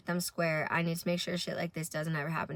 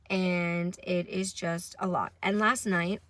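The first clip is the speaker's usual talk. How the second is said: ordinary delivery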